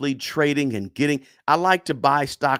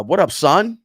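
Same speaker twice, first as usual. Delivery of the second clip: a quick, louder New York street greeting in a New York accent; a brief, self-conscious stab at a New York accent; 4 semitones higher, 7 dB louder than usual. Shown as a demonstration. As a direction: toss it off in a half-joking New York accent. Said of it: "give me something to say in New York accent".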